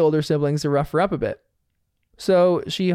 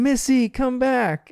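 mock yell; 8 semitones higher, slower than usual. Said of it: "both yell".

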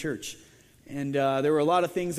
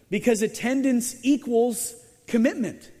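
higher, louder and slow, stressing equals; emphatic correction; 7 semitones higher, 4 dB louder than usual. Real, not acted. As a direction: land "equals" hard. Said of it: "preacher's correction".

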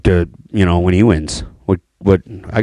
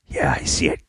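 quiet hushed reply; 6 semitones lower, 9 dB quieter than usual. Retold or imitated. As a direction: breathe it quietly. Retold hunting whisper.

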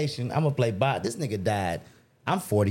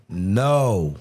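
authoritative inner voice; 5 semitones lower, 5 dB louder than usual. Retold or imitated. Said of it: "It's like".

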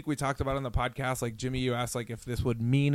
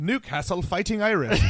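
put-on British accent; 6 semitones higher, 3 dB louder than usual. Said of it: "fun to say in the British accent".

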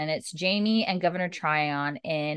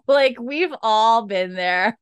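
laughing aside; 9 semitones higher, 5 dB louder, much slower than usual. Real, not acted.

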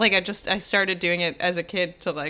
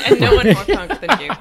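laughing; 8 semitones higher, 9 dB louder than usual. Real, not acted. Echoing the boast, laughing.

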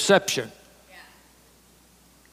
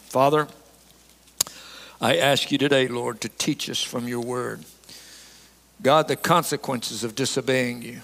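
lower, softer prayerful voice; 5 semitones lower, 3 dB quieter than usual. Real, not acted. Preacher asks God to teach the congregation, subdued prayer. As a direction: bring it down into earnest, subdued prayer.

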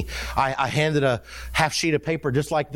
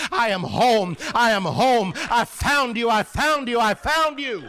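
emphatic cries climbing higher, acted out; retold joyful declaration; 8 semitones higher, slower than usual. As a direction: imitate a man triumphant at finally coming home. Retells the man's joyful climax.

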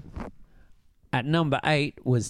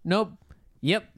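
curt one-word answers; 6 semitones higher, slower than usual. Retold or imitated. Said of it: "really short and kept saying".

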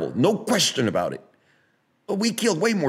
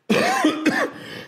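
sudden squeaky laugh, much higher; high-pitched laugh. Real, not acted. Admitting to viewers he holds NFTs, high laugh. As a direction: burst out in a high giggle.

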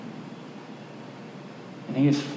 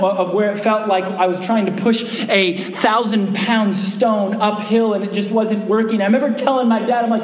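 ordinary talk

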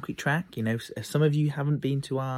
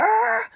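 very high, loud short scream; imitated scream; 10 dB louder than usual. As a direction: let out a terrified shriek. Girl frozen at the top.

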